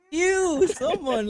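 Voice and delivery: high, loud squeal of disgust; disgusted kids. Retold or imitated. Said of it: "kids are like".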